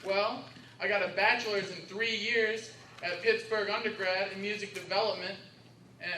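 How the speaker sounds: normal talking voice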